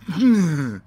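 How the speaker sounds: loud, high wordless outburst of protest; recoiling noise. Shown as a demonstration. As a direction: let out a startled, indignant noise. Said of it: "I'm gonna react like this".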